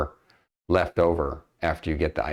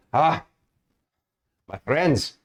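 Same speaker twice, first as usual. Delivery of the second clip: worried guild master, lightly voiced, a bit higher; guild master NPC; 7 semitones higher than usual. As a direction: GM playing a fretful guild master breaking bad news. GM voices Mister Zolk.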